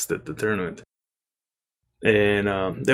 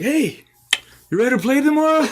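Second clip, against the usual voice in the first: imitating the coach, pitched much higher; a mocking, overly friendly coach voice. Retelling the coach's fake-friendly question, mocking high-pitched imitation.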